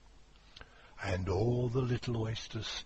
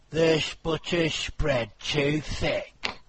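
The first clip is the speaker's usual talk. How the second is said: carpenter voice; 5 semitones higher, 6 dB louder, slower than usual. Acted. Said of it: "The carpenter said nothing but".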